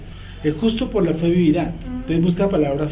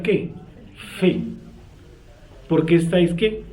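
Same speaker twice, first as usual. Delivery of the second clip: ordinary talk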